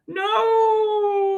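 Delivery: very high shocked cry; mock horror as the pencil strikes; dramatic no. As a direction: gasp out a cartoon no. Pencil threatens the character.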